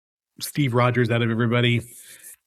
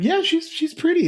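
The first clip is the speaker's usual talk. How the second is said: much higher, breezy imitated wife voice; wife agreeing. Husband imitating his unbothered wife, light high voice. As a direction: chirp it like an easygoing wife. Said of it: "And she's like".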